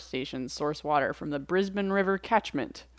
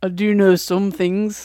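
Jon Snow impression; 2 semitones higher, 8 dB louder, slower than usual. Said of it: "a Jon Snow voice".

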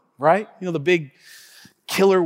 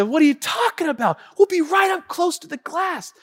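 put-on eager kid; 9 semitones higher than usual. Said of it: "And I said".

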